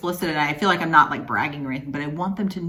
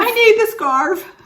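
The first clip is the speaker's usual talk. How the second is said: high, loud, drawn-out playful wail; mock needy plea; 10 dB louder, slower than usual. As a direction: beg for it in a hammed-up whine. Acting out someone wanting the scarf.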